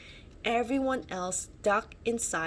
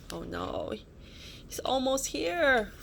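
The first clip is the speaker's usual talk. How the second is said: mock alarm; 5 semitones higher than usual. Real, not acted. Parent reading to child, mock alarmed aside.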